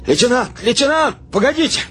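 high-pitched hail from afar; urgent, high calling. A narrator's reading. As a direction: shout after someone leaving. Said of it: "кричал кто-то".

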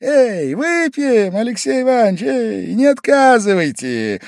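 put-on high, loud drunken coaxing; Pavel Pavlovich, cajoling. A narrator's reading. Guest urging Alexei Ivanovich to drink, high and cajoling. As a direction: call out high and drawn, urging him.

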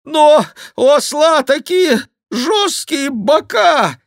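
high, loud, drawn-out complaint; wailing monk. A narrator's reading. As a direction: wail it slowly at a high pitch. Monk wails about the hard ride. (протяжная громкая жалоба монаха)

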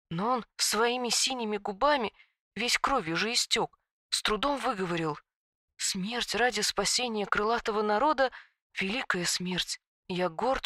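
soft, hushed tragic telling; tragic whisper. A narrator's reading. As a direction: hush it like a sad secret. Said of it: "трагическим шёпотом произнесла".

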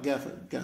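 "جاف، جا" starting on a voiced g, as in go; Yemeni qaf. Shown as a demonstration. Teacher imitating a lifelong Yemeni speaker, "جاف، جا" with hard g. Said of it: "ينطق القاف".

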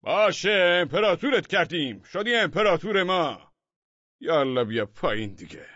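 loud, high giving-in yell; shouting crowd. A narrator's reading. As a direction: shout up in surrender. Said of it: "فریاد زنان گفتند".

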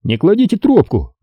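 high-pitched switchboard order; sergeant's voice. A narrator's reading. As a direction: tell the caller high and briskly to hold. Sergeant puts caller on hold.

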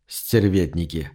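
a touch of sorrowful lament; rueful. Acted. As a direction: say it with mock sorrow, shaking your head. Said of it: "сокрушённо проговорил он".

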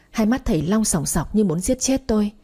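ordinary delivery

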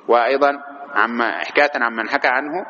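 ordinary talk

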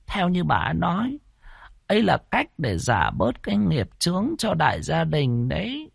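put-on old midwife explaining to the grandson. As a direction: tell the hard truth gently. Old midwife voiced retelling.

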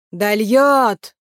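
loud, drawn-out call with a falling end; spoken call. A narrator's reading. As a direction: call the word out, stretching the last vowel. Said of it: "промолвил он и повторил громче".

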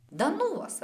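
high-pitched, upset dismissal. A narrator's reading. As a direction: brush them off in a sulk. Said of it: "окончательно расстроилась я".

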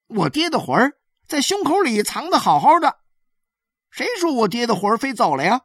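put-on high-pitched child insisting; boy protesting. A narrator's reading. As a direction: argue back in a small boy's high voice. Boy contradicts the woman from the roof.

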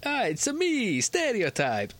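high, hammed-up Italian caricature; Mario. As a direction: leap up into a cartoon Italian voice. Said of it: "it's his voice that's a stereotype".

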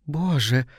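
mildly sighed oath; understated sigh of dismay; sighed exclamation. A narrator's reading. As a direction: let it out on a sigh. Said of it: "вздохнула Мисси".

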